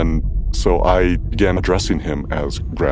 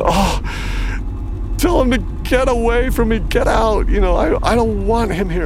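much higher, fast and pleading; frightened pleading; 3 dB louder than usual. Retold or imitated. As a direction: beg him away from you in a panic. Possessed sister pleads, lucid again.

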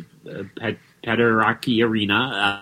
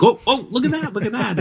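high-pitched excited exclaiming, pitched way up; 13 semitones higher than usual. Retold or imitated. Fan spotting a comics character on screen, high and excited. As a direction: mimic pointing at the screen in delighted recognition. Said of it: "heard me getting all excited".

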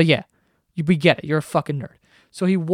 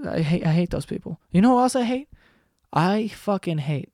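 ordinary talk, nothing marked

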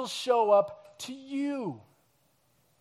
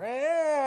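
put-on a higher, drawn-out toddler whimper; imitating a longer, louder cry; 7 semitones higher than usual. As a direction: mimic the child's cry stretching out. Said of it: "it gets a little bit louder, a little bit longer".

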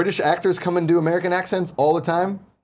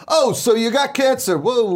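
higher, louder New York doctor caricature; mock New York doctor; 10 semitones higher, 4 dB louder than usual. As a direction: play a brash New York doctor, pitched up. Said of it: "No doctor in New York's gonna come in and be like".

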